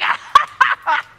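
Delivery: loud high gloating cackle; gleeful cackle. Acted. Charwoman to the fence, gloating over the loot: loud cackle.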